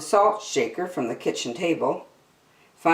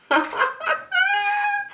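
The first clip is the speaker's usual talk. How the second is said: put-on high, bubbling girlish laugh. A narrator's reading. Beatrice laughing onstage after her mistake, high bubbling laugh. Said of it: "the chuckle became a laugh".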